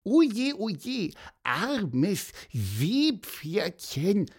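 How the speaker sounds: high cooing sympathy, slowing right down; drawn-out pity in a bird voice; Pieper, pitying. A narrator's reading. Pieper pitying the seahorse, high and drawn out.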